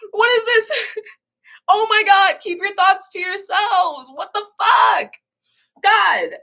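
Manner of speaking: crying through angry words; near tears. Real, not acted. Venting to chat, close to crying with anger. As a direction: voice cracking into tears. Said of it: "I'm way too emotional".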